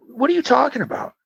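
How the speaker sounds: high-pitched exasperated retort; baffled protest at an absurd request; high, incredulous comeback. Retold or imitated. Incredulous retort to passenger count.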